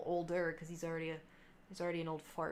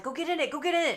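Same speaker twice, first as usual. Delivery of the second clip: high, loud, eager aunt; excited mock urging; retold excited urging; 8 semitones higher, 7 dB louder, faster than usual. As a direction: imitate an aunt egging someone on.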